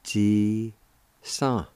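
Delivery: Ji and san held apart as two syllables. Shown as a demonstration. Teacher showing how Jesus is pronounced, syllable by syllable. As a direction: sound out "Ji ... san" with a clear gap. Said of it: "it's pronounced like this".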